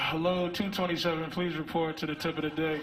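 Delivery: low, even intercom announcer; store PA announcement. Pretend. Sperm paged over the intercom, flat announcer voice. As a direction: read it like a store loudspeaker. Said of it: "shit comes out like".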